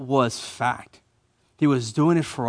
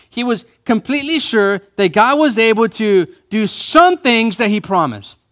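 some punched high and loud; deliberately misquote, leaning hard on some; raised preaching voice with heavy weight on some; stress on some; 6 semitones higher, 4 dB louder than usual. Shown as a demonstration. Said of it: "let me change that a little bit".

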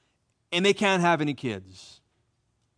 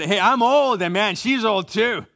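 blunt husband; 4 semitones higher, 4 dB louder than usual. Retold or imitated. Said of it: "he could have said".

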